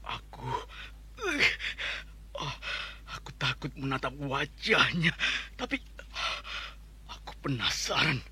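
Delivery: soft, low, scared thinking aloud; fearful inner monologue. Acted. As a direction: whisper your fear to yourself.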